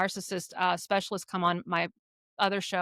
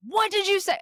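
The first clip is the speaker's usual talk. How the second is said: a sharp, much higher retold snap at her daughter; mock angry snap; 11 semitones higher than usual. Mother retelling how she snapped at her daughter, mock angry snap. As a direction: imitate yourself losing it in one line, pitched way up. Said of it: "And I just lost it".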